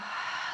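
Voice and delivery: short, soft sigh of breath; demonstrated exhale. Shown as a demonstration. Teacher showing when to let the head go, audible exhale. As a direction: let a quick voiceless breath fall out.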